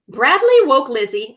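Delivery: ordinary delivery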